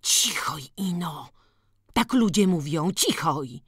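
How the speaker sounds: usual speaking voice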